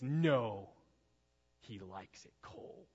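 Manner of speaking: put-on a faint, hushed creepy child's line, slow at the end; a hint of a creepy possessed boy; creepy kid voice. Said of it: "is like that creepy".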